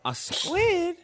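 put-on high, loud girl's voice, puzzled. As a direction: echo it in disbelief as a young girl. GM voicing Harriet.

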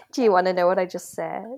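usual speaking voice

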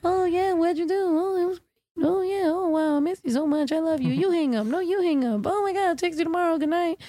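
lightly mocking, lovey-dovey and high; a hint of a gushing couple on the phone; giggly late night call.

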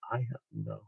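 broken, near-silent whisper through tears; imitating a breakdown, softly; tearful. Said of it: "started to cry hysterically".

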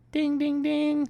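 high sung ding of a bell; bell imitation. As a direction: ring it out like a boxing bell.

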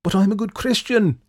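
loud, high indignant protest. A narrator's reading. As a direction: protest your innocence. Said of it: "Jack protested".

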